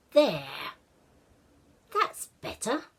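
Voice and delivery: a lightly voiced young boy, pleased; the boy steps back, pleased with his work; boy Ashton. Ashton's satisfied line.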